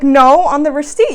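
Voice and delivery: loud, emphatic correction; indignant retort. Acted. Worker corrects a customer about the receipt, loud indignant retort.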